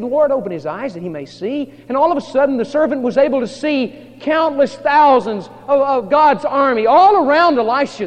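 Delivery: loud, high-pitched, swelling sermon climax; full-force preaching. Acted. Preacher revealing God's army to congregation, full force. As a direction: proclaim the miracle at full force.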